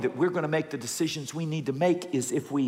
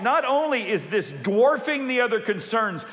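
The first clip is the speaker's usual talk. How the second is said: normal talking voice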